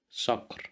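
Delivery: صقر said clearly, emphatic s with a; model word. Shown as a demonstration. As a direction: voice صقر softly for the learners. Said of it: "you will listen to me".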